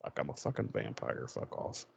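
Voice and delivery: low, quiet, voiceless growl; voiceless menacing vampire mutter. Retold or imitated. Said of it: "Angel's like".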